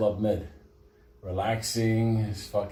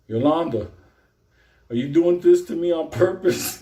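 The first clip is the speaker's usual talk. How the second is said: louder, higher, aggrieved; mock whiny yell; 6 semitones higher, 6 dB louder than usual. Inmate calling out to absent Yolanda, louder and whiny. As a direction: whine it out like he's betrayed.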